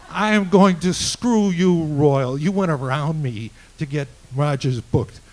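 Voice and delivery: loud, slow, hammered-out words; retold furious threat. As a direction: imitate an enraged caller spelling out revenge. Retold caller threatens him in anger.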